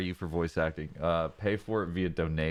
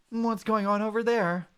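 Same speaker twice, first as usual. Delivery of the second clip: put-on raised, startled young voice; alarmed partner Mudkip; 14 semitones higher than usual. Mudkip partner spotting someone collapsed on the sand, startled and raised. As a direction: gasp and hurry over in alarm.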